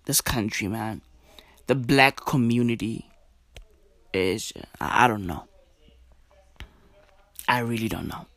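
low, soft and halting, real dismay; hushed, deflated resignation; at a loss. Real, not acted. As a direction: trail through it quietly, lost for words. At a loss after reading her tweets.

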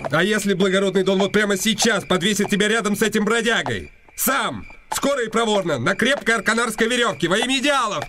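town crier. Acted. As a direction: call it out like a herald on the square. Mock crier tone, threatening. (высоко и протяжно, как глашатай на площади)

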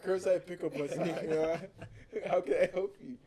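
loud, high, laughing customer-service hello; hyped phone greeting. Retold or imitated. Clerk greeting a caller with loud hype. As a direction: answer the phone all pumped up. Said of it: "But I answer the phone like".

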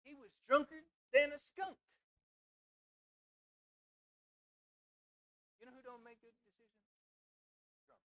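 loud, rising preacher's exhortation; preaching at full force. Acted. Preacher urging the church to serve God, full preaching force.

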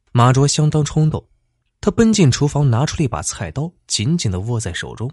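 plain reading voice

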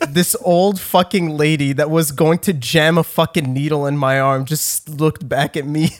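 ordinary delivery

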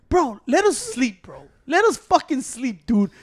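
slow, high pleading. Retold or imitated. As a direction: drag it out, begging to sleep. Begging for sleep.

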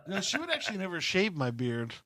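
ordinary delivery

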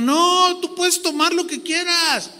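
high, carefree mock preacher; mock permissive preacher. Quotes another false teacher. (imitación ligera de un predicador permisivo, aguda)

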